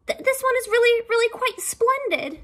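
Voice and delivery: high, fast stuttering turtle voice; stammering turtle. Acted. Turtle nervously begging the king, high and stammering. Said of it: "said the turtle".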